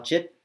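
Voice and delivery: short vowel chit. Shown as a demonstration. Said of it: "It's a short vowel".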